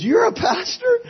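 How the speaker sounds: very high-pitched mock astonishment; amazed disbelief. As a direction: act out a squeaky, stunned question. Retold amazement at friend being pastor.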